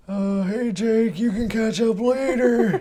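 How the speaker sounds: put-on high, slow wavering old man. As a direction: play a quavery old man, slowly. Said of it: "I can also do the old man voice".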